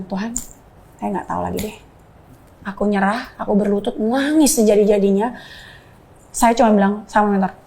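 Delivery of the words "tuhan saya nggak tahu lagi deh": hushed surrender. Retold or imitated. Surrender prayer, near whisper.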